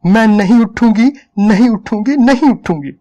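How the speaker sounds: screaming refusal. A narrator's reading. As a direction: scream it at him, she won't budge. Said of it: "औरत चिल्लाने लगी".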